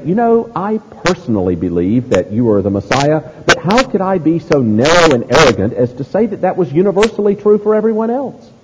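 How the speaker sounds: hammed-up hedging, rising to a high finish; put-on politically correct waffling; mock relativist disciple. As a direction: climb in pitch as if apologising for believing. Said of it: "He doesn't say".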